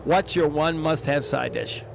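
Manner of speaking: ordinary delivery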